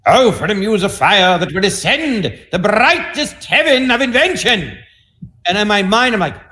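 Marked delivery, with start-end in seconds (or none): 0.1-4.8 high, slow theatrical declamation; Shakespearean actor professor. Retold or imitated. Professor reciting Shakespeare to first college class, grand declamation. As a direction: imitate a grand classical thespian in full cry. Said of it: "I'm gonna do some Shakespeare for you".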